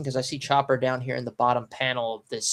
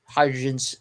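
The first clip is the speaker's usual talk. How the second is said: normal talking voice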